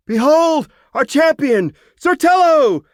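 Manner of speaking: high, loud ringing cheer. A narrator's reading. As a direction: present the hero to the crowd at full voice. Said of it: "cheered Dagiloo".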